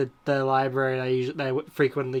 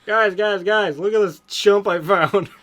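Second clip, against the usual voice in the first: higher, louder excited character call; mild mock screech of joy; joyous screech; 5 semitones higher, 6 dB louder, slower than usual. A narrator's reading. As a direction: show off your find to the family. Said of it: "Ronald screeched joyously".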